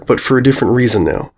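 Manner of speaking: usual speaking voice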